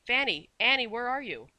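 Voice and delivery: loud, high call into a dark shop. A narrator's reading. Nanny calls for the shopkeepers, loud and high. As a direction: call out so it echoes off the walls. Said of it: "Mary called out".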